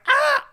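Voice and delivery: very high, loud burst of delight; loud joyful yelp. Retold or imitated. Student cheering the verdict in a silent class, yelping. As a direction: yelp out before you can stop it. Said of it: "And I was like".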